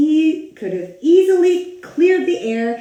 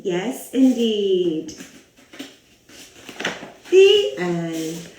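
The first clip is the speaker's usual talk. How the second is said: ordinary delivery, nothing marked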